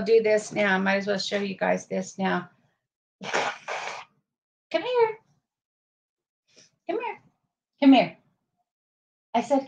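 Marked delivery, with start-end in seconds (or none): 3.2-7.2 high soft coaxing call to a dog. Real, not acted. 7.8-8.2 firm dog call. Real, not acted. Louder firmer call.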